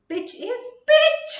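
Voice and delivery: high, loud squeak on "Pitch is pitch"; high pitch demo. Shown as a demonstration. Teacher showing students a high pitch, loud and slow. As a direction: jump way up and belt "Pitch is pitch" slowly. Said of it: "What is pitch?".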